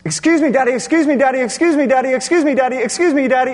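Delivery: high, loud nonstop repetition; nagging child. Retold or imitated. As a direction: imitate a child pestering Dad without a breath. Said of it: "she just does that nonstop".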